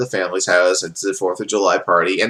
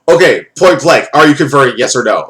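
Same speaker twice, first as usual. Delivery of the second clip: mock blunt demand; 5 semitones higher, 7 dB louder than usual. Brothers turn to blunt demand.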